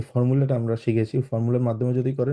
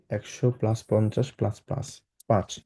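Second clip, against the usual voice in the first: slow, softer teacher breakdown; 4 dB quieter, much slower than usual. Shown as a demonstration. Teacher showing students how to split the number slowly. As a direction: lay out each part slowly and softly. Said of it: "numbering যেভাবে করেছি".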